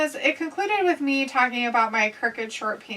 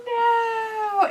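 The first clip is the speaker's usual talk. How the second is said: high, drawn-out mock "no"; acted-out cry; 13 semitones higher than usual. Retelling a comedy scene to co-host, mock cry. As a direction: imitate a movie character's high wail. Acts out a Deuce Bigalow scene.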